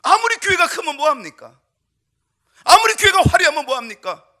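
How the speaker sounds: loud, high rhetorical preaching; fervent preaching. Acted. Pastor challenging the church, loud fervent preaching. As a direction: hammer each question at the congregation.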